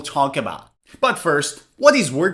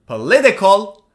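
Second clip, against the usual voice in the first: "Political" with LIT punched; "Political" peaking on the second syllable; stress on second syllable; 2 semitones higher, 4 dB louder than usual. Shown as a demonstration. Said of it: "read the three of these words".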